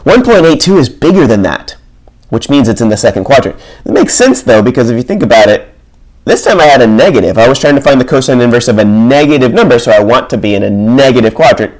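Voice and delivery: ordinary talk, nothing marked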